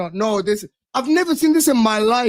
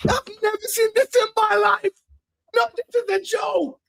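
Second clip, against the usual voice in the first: higher, stunned disbelief; 6 semitones higher than usual. Real, not acted. Chelsea fan seeing the transfer tweet, stunned disbelief. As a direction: react like you can't believe it.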